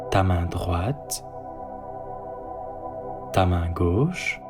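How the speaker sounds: ordinary delivery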